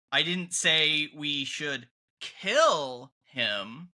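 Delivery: slow, pause then hit "kill"; stress on KILL. Shown as a demonstration. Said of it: "emphasize a different word in the sentence".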